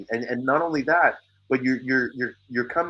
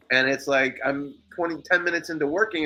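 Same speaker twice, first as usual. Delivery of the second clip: normal talking voice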